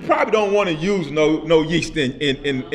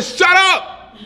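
sudden high, loud shout; yelled shut up; 11 semitones higher, 6 dB louder than usual. Retold or imitated. Preacher replays telling off a friend who won't listen: sudden high shout. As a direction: snap it out like you've had enough. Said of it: "I yelled at her".

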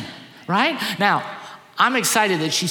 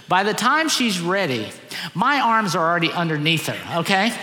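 ordinary talk

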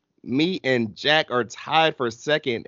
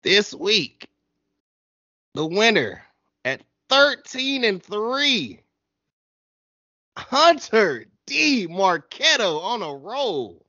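mock announcer build-up, higher pitched; hype announcer; 8 semitones higher, slower than usual. Podcast host hyping the winner, mock announcer voice. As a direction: crown the weekly winner like a host.

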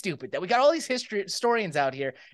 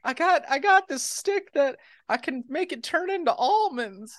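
put-on kid brother voice; 4 semitones higher than usual. Little brother pleading in skit.

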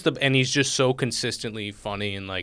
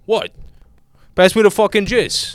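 higher, louder mock-insistent dad; doubling down seriously; 7 semitones higher, 4 dB louder than usual. Imitating dad who refuses the joke, insistent. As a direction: demand it as if nothing's funny. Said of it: "him being serious about it".

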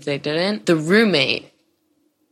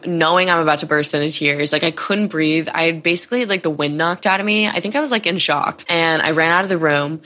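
usual speaking voice

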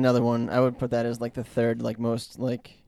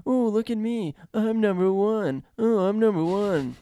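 high, slow sneering taunt; mocking imitation; 9 semitones higher, 3 dB louder, slower than usual. Number one mocks Homer, high slow taunt. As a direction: drag it out high to mock him. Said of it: "starts mocking his name".

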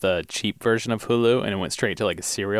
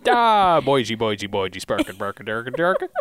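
goofy fake-Swedish nonsense babble; mock Swedish Muppet. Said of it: "she went full Muppet".

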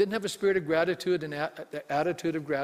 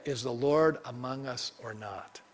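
slow, low doubting question in the Israelites' mouth; slow quoted question; 5 semitones lower, 3 dB quieter, slower than usual. Retold or imitated. Preacher quotes the Israelites doubting, slow and low. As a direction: drag out the doubt, 'Is the Lord among us or not?'. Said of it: "And you said".

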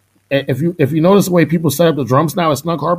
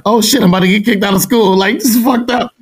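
hammed-up freak-out about getting expelled; mock panic; 8 semitones higher than usual. Caught smoking weed by Ellis, mock panicked. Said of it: "I'm freaking out".

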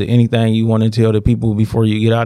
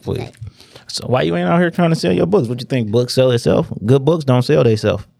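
ordinary talk

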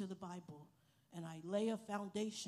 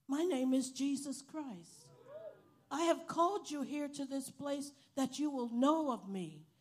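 impassioned preaching; 5 semitones higher, 8 dB louder than usual. Real, not acted. Preaching climax reading God's note.

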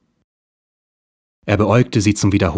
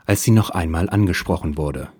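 plain reading voice

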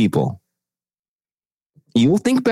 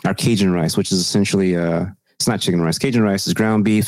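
normal talking voice

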